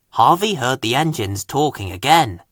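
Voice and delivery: ordinary delivery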